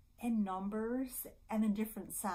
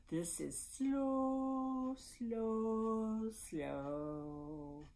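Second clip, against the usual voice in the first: very slow; 2 semitones higher, much slower than usual. Shown as a demonstration. Librarian acting out slow for babies, very drawn-out. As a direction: drag each SLOW out, stepping down in pitch.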